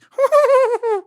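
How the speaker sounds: high squealing fake neigh; whinny like a horse, badly; horse neigh imitation. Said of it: "It's a horrible horse impression".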